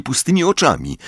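ordinary delivery

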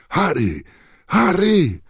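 loud, rising anxious calls; shouting his name. Acted. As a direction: call out to him urgently. Crowd shouting Harry's name.

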